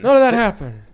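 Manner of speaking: a hint of a mock politician brushing it off; mocking Biden denial. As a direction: shrug it off as if it never happened. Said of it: "tries to walk back or claim things never happened".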